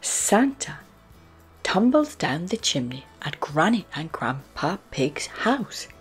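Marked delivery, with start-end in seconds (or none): none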